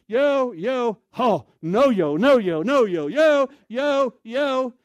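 high loud yo, no, yo; squeezing past passengers, talking the language; loud yo chatter. Retold or imitated. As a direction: chant yo and no loudly. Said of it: "I always try to talk the language".

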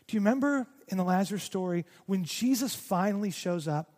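ordinary talk, nothing marked

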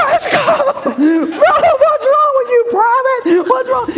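hammed-up drill sergeant shouting at a recruit; drill sergeant yell. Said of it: "yell at his feet".

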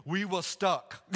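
imitated Ugandan accent. Stranded driver explaining to the pastor, in a Ugandan accent. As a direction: put on a Ugandan man's accent. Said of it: "This is an awesome accent".

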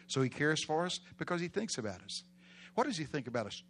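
ordinary delivery

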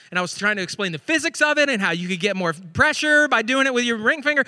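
ordinary talk

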